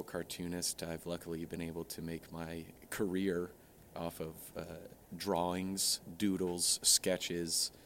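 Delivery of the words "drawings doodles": ordinary talk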